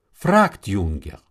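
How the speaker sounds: ordinary delivery, nothing marked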